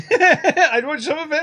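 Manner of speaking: high, slow, laughing through it. Shown as a demonstration. Repeats own line, laughing high.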